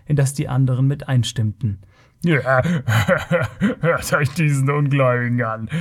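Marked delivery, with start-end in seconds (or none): 2.3-5.7 jeering Kothian bellowing through mocking laughter; spöttisch lachend. A narrator's reading. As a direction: laugh scornfully as you mock him. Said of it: "brach dann in spöttisches Gelächter aus". (spöttisches, grölendes Gelächter)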